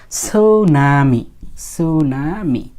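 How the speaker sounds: সুনামি stretched very slowly, syllable by syllable; teacher sounding out সুনামি at length; silent-T demonstration. Shown as a demonstration. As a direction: draw out সু-না-মি, opening on s with no t. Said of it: "টি এর উচ্চারণটা ওরা করে না".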